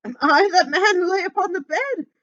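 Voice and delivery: high, loud anguished cry. A narrator's reading. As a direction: wail it out on your knees. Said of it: "he cried upon his knees".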